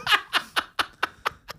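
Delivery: airy, high laugh; breathy laugh. Real, not acted.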